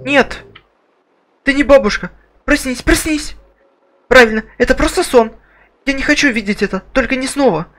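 put-on high, loud, panicked girl. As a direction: cry out as a terrified girl. Voices the girl character.

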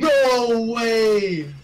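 shouted disbelief. Real, not acted. Player reacting to a botched play, shouted disbelief. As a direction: cry out as if you can't believe it.

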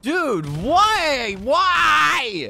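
real high, loud wail of why; exasperated yell. Real, not acted. Gamer after choking a shot, exasperated yell. Exasperated at missed shots in game.

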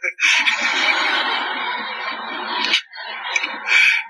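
long laugh at his own story; real laughter. Real, not acted. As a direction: burst out laughing. Laughs at the merged sacraments joke. (παρατεταμένο γέλιο)

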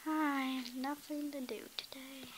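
soft bored whisper; hushed lament. Acted. Princess whispering to herself.

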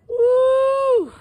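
high cheer; excited whoop. Real, not acted. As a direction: whoop with joy. Cheering over the fish.

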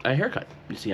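ordinary delivery

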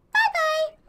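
very high little goodbye; mock squeaky farewell. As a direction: dismiss them in a tiny high voice. Mocking dismissal of denied traveller.

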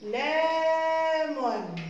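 drawn-out word; slow dictation. Shown as a demonstration. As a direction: stretch it for the class to write. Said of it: "dictating the word slowly".

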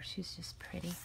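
hushed admiring whisper. Real, not acted. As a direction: breathe it out softly to yourself. Admiring card quietly.

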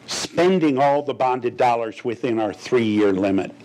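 normal talking voice

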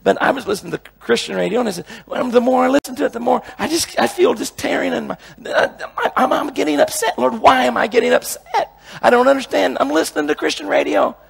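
high-pitched, fast, frustrated pleading; retold upset prayer. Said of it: "I, one time I got upset".

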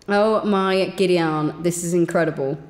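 ordinary talk, nothing marked

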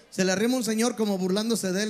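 ordinary delivery

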